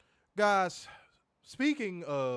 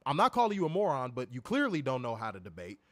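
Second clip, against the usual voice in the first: normal talking voice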